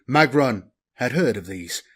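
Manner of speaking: ordinary delivery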